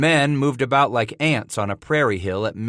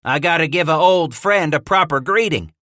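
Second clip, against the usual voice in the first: rowdy trapper; 6 semitones higher, 5 dB louder than usual. A narrator's reading. Hatcher defending himself.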